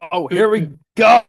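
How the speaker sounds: hyped up for the vote reveal; excited. Real, not acted. Before the finale votes, an excited shout. Host about to read votes.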